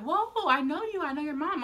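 lightly acted surprised bystander; startled recognition. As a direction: retell sudden recognition in a high voice. Neighbour recognising the injured girl.

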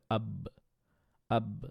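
আব with the ব bounced open after closing; qalqala demo. Shown as a demonstration. Teacher shows qalqala on বা. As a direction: close on ব, then release it. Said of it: "মুখটা বন্ধ করে আবার খুলতে হবে".

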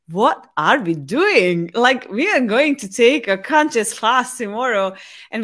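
loud, incredulous self-scolding; emphatic retold realization; self-reproach. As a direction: catch yourself and exclaim in dismay. Acting out sudden self-reproach.

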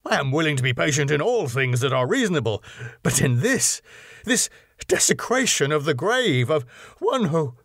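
words tumbling out in fury; building to a choked break; indignant. A narrator's reading. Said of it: "he fairly choked with indignation".

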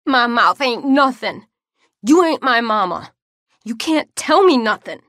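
put-on high, loud child's screaming tantrum; wailing child. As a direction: scream it like a defiant kid. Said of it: "I was wailing".

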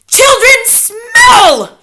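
very slow, high witch scream. A narrator's reading. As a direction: stretch both words into a huge shriek. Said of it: "she screamed".

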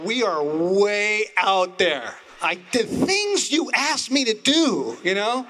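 loud, high, drawn-out complaint; exasperated protest. Retold or imitated. Preacher replaying his outburst to God, exasperated and loud. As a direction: protest loudly, pitch climbing in disbelief.

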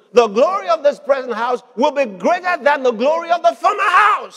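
high, building to a shout on "house"; rousing proclamation. Real, not acted. Preacher reading God's promise aloud, rousing proclamation. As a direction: declaim the verse, climbing to a loud peak.

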